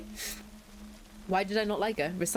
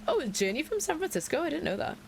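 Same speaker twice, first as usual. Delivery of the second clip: much higher, exaggerated wonder; mock surprise; 9 semitones higher than usual. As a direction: fake amazement.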